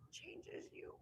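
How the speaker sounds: whisper. Real, not acted. Emotional confession to host.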